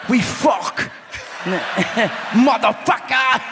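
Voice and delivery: high, shouted Scottish swearing; hammed-up Scottish yell; fake Scottish accent. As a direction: holler it in mock Scottish. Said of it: "That's how they say sex".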